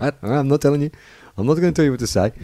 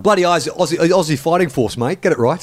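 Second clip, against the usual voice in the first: higher and a bit louder, a blokey patriot; mock ocker Aussie; 8 semitones higher, 4 dB louder than usual. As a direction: play the flag-waving Aussie bloke correcting a mate. Mock patriot correcting the name.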